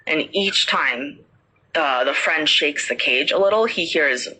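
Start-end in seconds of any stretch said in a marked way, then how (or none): none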